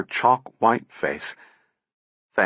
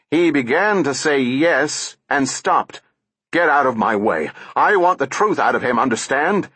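angry and impatient; 5 semitones higher than usual. A narrator's reading. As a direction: push past him, demanding. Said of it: "Lanning angry and impatient".

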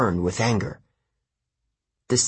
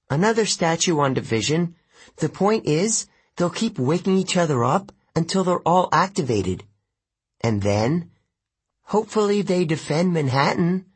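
put-on higher girl's voice; Annabeth voice; 8 semitones higher than usual. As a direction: lightly voice Annabeth. Annabeth's voice in narration.

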